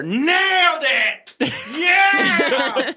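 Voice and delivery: high, drawn-out mock shout; hammed-up, stretched victory yell; triumphant cheer. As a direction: whoop it like you're thrilled backstage. Mimics boss celebrating backstage.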